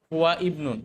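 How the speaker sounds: হুয়া ইবনুন as two separate words; hamza pronounced. Shown as a demonstration. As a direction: say হুয়া, then begin ইবনুন on a clear i sound. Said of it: "ইবনুন উচ্চারণ করি".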